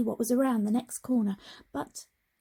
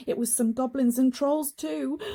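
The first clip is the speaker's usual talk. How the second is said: ordinary delivery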